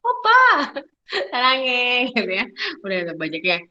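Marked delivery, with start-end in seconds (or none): 0.1-2.1 squealing high Korean drama fangirl; K-drama fan. Retold or imitated. Fan gushing to her oppa, loud squealing Korean. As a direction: gush it like a swooning K-drama fan. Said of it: "taunya debak gitu ya".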